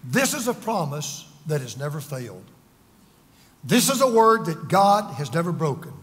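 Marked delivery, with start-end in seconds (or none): none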